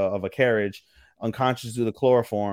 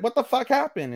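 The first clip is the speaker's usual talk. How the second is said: high-pitched acted disbelief; shocked; 12 semitones higher than usual. Ichiro finding everyone depressed, retold as shocked. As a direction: play walking in on a mess, startled. Acting out his shocked question.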